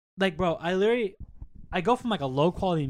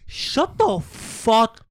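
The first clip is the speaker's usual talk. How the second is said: slow, louder irritated rant; annoyed; 6 semitones higher, 7 dB louder, much slower than usual. Real, not acted. Fed up with people who cheer, slow and loud. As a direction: drag it out, fed up.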